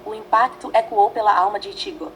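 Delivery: usual speaking voice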